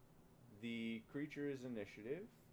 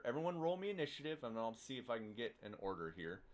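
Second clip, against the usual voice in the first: ordinary talk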